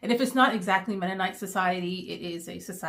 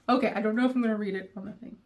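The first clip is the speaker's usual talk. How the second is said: normal talking voice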